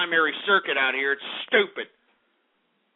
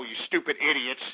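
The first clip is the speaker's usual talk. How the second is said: ordinary talk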